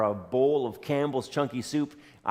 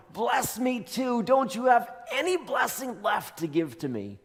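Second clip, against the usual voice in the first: high, pleading cry to his father; a wailing plea, voice breaking upward; desperate son begging his father, crying out; 10 semitones higher than usual. A narrator's reading. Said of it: "He's crying out to his father".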